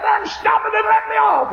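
high, loud shouted demand; frantic shout. Retold or imitated. Old woman screaming at the flagman to stop. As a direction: scream to be let off. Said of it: "She got frantic and hysterical".

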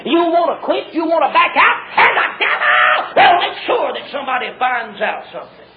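hard-driving revival preaching; full-force preaching. Real, not acted. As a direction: warn the congregation with mounting urgency.

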